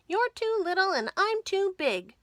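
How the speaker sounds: high, loud squeaky mouse voice; What's-His-Name's voice. A narrator's reading. Mouse teasing his seesaw friend, high and loud. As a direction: squeak it out like a big-headed mouse. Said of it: "said What's-His-Name to Thingamajig".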